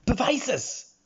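very high, loud panicked cry; almost panicked scream. A narrator's reading. Fighter demanding proof, high panicked scream. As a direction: shriek the demand. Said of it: "schrie sie nun beinahe panisch".

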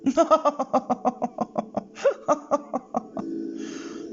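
long high cackle of delight; gleeful witch cackle. Acted. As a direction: cackle with glee. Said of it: "Lachte die Hexe vergnügt". (vergnügtes Hexenkichern)

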